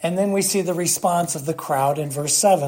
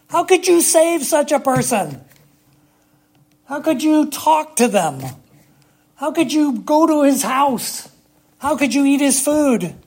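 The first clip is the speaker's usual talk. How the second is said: higher, louder scandalised questions; mock the shocked crowd's outrage; indignant crowd; 8 semitones higher, 5 dB louder than usual. Preacher voicing the indignant crowd.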